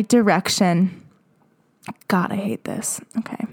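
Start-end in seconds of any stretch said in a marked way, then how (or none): 2.1-3.5 muttered cringe. Real, not acted. Cringing reaction to the story.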